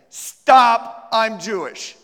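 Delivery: loud, commanding halt like a traffic cop; traffic cop stop. Shown as a demonstration. Teacher demonstrates a traffic-cop stop for the volunteer, loud and commanding. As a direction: bark it out to stop someone in their tracks. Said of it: "pretend you're a traffic cop".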